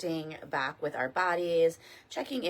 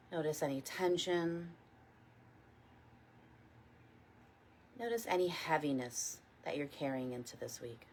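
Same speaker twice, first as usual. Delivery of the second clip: guided meditation. Real, not acted. Teacher leading a morning body scan, calm guided meditation. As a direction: guide them gently, unhurried, letting each phrase settle.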